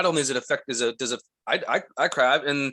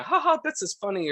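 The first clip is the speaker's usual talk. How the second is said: high, imitated giggly bystander; mock laughter; 9 semitones higher than usual. Imitating girls who stood there laughing, high mock amusement. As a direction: fake a light, high ha-ha.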